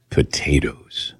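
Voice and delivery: low, slow hushed reveal; drawn out like a secret; imitating the doctor's low hush. Doctor revealing the diet news in a slow hush. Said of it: "he talked like this".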